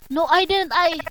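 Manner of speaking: high, loud protest; panicked denial. Acted. Accused lover protesting innocence, panicked denial. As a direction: deny it desperately.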